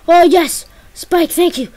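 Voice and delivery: ordinary delivery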